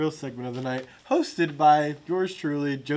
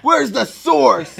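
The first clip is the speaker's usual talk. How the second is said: high, loud and slow, laughing; excited rapper; 8 semitones higher, 12 dB louder, much slower than usual. Retold or imitated. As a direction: demand it with eager delight. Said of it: "they're gonna be like".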